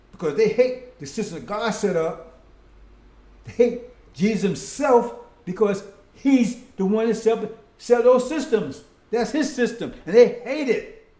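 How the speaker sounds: rising, thundering pulpit delivery; full-force preaching. Acted. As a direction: preach it hard to the congregation.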